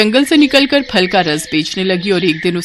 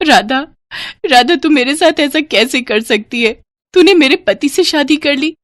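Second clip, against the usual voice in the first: high-pitched, mildly stunned reproach; shocked; 7 semitones higher than usual. Acted. Wife confronts Radha over the wedding, shocked. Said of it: "सुधा को जैसे सदमा लग गया".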